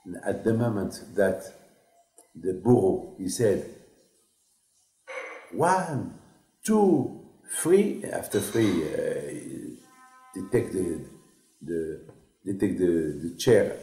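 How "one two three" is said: each number climbing much higher; rising count. Retold or imitated. Executioner counting down the hanging, rising higher. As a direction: count up to the drop with mounting tension.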